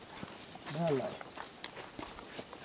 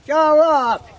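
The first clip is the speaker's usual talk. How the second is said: calling out; 7 semitones higher, 8 dB louder, much slower than usual. Real, not acted. Greeting someone from afar.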